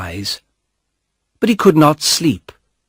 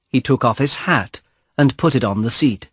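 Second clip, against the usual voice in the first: ordinary delivery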